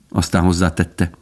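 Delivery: plain reading voice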